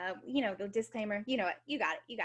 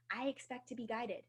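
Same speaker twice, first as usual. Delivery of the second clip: ordinary talk, nothing marked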